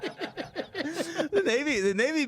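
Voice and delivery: slow, high, laughing through it. Real, not acted. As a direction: dissolve into laughter on the word.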